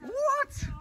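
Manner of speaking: high startled what; disbelieving squeak. Real, not acted. Disbelief after goal.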